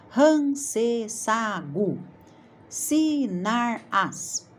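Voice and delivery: scrambled syllables read out slowly; slow, careful jumble read to pupils. Shown as a demonstration. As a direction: sound out jumbled syllables for the class. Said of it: "scrambled syllables".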